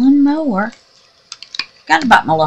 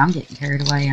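ordinary delivery

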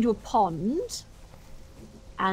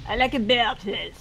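put-on French accent.

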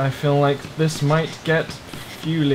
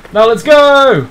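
loud, high call to set off; excited high yell; rallying shout; 9 semitones higher, 9 dB louder, slower than usual. Real, not acted. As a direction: shout it to get everyone moving. Rallying call as drive begins.

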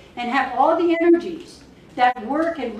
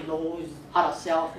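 ordinary talk

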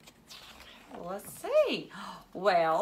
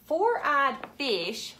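excited reveal; 6 semitones higher, 5 dB louder, slower than usual. Acted. Reading picture book to kids.

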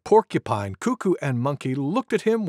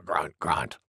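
very low, soft grunting; boar grunts; 12 semitones lower, 6 dB quieter than usual. Acted. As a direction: grunt low after the effort. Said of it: "said Wild Boar".

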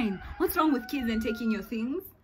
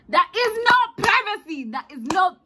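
much higher and louder, punching "not"; emphatic shout; 10 semitones higher, 12 dB louder than usual. Real, not acted. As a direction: shout it, outraged. Insisting on lost dorm privacy.

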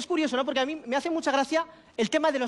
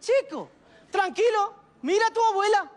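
mock exasperated call; 7 semitones higher than usual. Calling out to the fretting lad.

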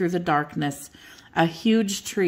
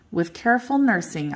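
usual speaking voice

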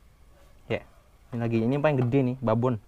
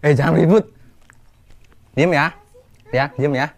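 hushing loudly; 2 semitones higher, 6 dB louder than usual. Real, not acted. Hushing noise during video.

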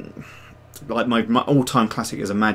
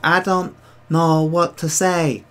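slow, higher character voice; put-on viewer voice; 6 semitones higher, 4 dB louder, slower than usual. Streamer trying out a voice for a viewer, slow and raised. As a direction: draw it out slowly in a put-on voice. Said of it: "I do voices for different people".

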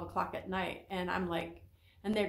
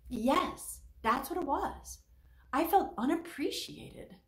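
imitating a relieved realization; 7 semitones higher, slower than usual. Mimicking a client naming the emotion.

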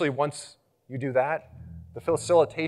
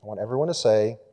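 ordinary talk

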